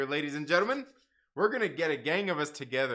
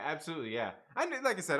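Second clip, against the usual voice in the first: usual speaking voice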